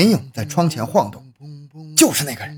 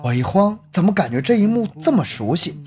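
ordinary delivery

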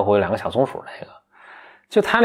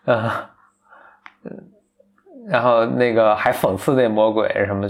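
normal talking voice

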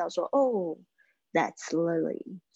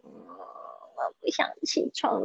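1 sleepy grumble; 5 semitones lower, 5 dB quieter, slower than usual. Retold or imitated. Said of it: "就是会有那种就是".

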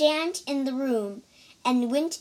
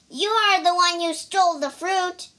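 higher, louder protesting cry; cried; 6 semitones higher, 5 dB louder, faster than usual. A narrator's reading. The pig blaming Wukong, loud protesting cry. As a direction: shove the blame back at him. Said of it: "cried the pig".

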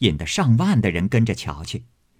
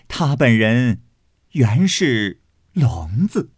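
slow punchline; slower than usual. Acted. Deadpan punchline.

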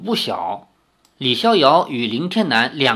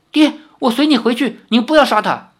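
higher, louder urgent plea; frightened pleading; 8 semitones higher, 5 dB louder, faster than usual. Acted. Lin Yueru shields Li Xiaoyao, begging her father in a frightened, higher voice. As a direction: beg father to spare him. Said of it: "林月如吓得挡在李逍遥面前".

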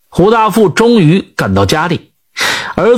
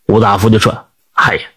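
ordinary delivery, nothing marked

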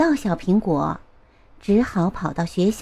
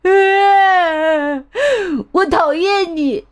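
put-on high, loud tearful yell of an upset boy; loud crying outburst; 7 semitones higher, 4 dB louder, slower than usual. 阿志 shouting at the teacher that he hates the hearts, high loud crying yell. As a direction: scream it out through tears, hurt and angry. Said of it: "阿志大声地对老师说".

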